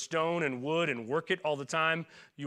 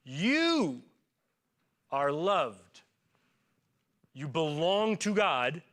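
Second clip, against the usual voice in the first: sincere blessing; much slower than usual. Real, not acted. Pastor blessing the congregation.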